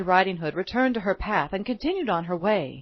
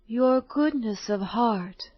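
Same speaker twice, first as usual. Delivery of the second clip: slow, solemn fairy voice; kind fairy; slower than usual. Acted. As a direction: bless her gently.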